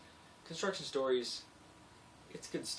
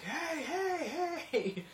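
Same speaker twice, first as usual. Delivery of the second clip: high, loud mock "hey hey hey"; mock warning; 6 dB louder, much slower than usual. Iron workers warning him, high and loud. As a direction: squeal a loud fake warning. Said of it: "they're like".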